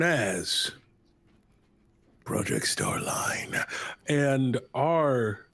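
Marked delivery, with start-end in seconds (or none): 2.2-3.6 dramatic whisper. Acted. Dramatic title reveal.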